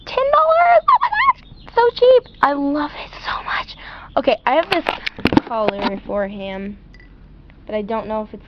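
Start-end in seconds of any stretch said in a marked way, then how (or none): 0.1-2.2 very high, disbelieving ten dollars; surprised. Real, not acted. 2.4-3.8 soft whispered gush. Real, not acted. Whispering her love for the new plush, hushed. Hushed aside over the pet.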